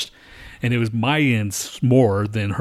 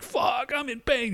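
high but soft mock scream; held-back, high-pitched retold cry; imitated pain scream; 13 semitones higher, 5 dB quieter than usual. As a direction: mimic a pained wail without the volume. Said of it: "just screaming".

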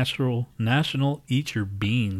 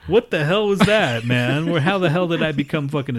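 loud, high-pitched disbelief; real incredulous outburst; 6 semitones higher, 6 dB louder than usual. Real, not acted. Host reacting to co-host's awful accent, loudly incredulous. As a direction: protest in amused bafflement.

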